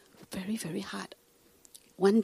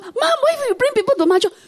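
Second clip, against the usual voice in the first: high, quick, laughing reproach; laughing scold; 13 semitones higher, 5 dB louder, faster than usual. Retold or imitated. Daughter phones mom to scold her about mahjong, high and laughing.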